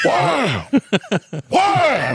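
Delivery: high laughing shouts of wow; dad's mock shout. Dad voiced in retold story.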